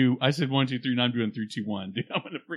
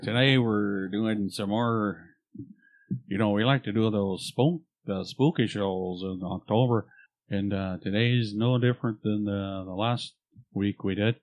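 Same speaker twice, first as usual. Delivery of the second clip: put-on Midwestern accent; 6 semitones lower than usual. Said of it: "Your Canadian sucks".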